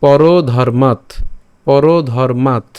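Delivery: slow পরধর্মাৎ ending on a closed t; "paro-dharmaat" for পরধর্মাৎ, said slowly; stopped final t. Shown as a demonstration. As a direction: draw out পরধর্মাৎ, no vowel after the last t. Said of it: "এখানে কিন্তু মাতে উচ্চারণ করিনি".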